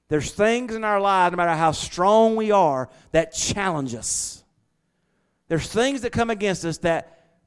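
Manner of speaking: loud, rising preacher's cry; fiery preaching. Acted. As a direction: preach it out to the congregation. Preaching at full force.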